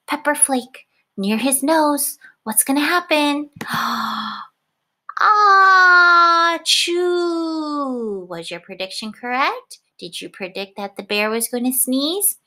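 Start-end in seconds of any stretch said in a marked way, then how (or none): 3.5-4.4 mock gasp. Pepper near bear's nose. 5.2-8.3 loud high hammed-up sneeze; a huge cartoon bear sneeze; big sneeze.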